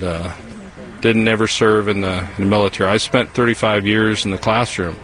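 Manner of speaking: usual speaking voice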